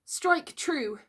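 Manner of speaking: high, resolute command; firm. A narrator's reading. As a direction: accept the blow bravely. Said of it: "he said firmly".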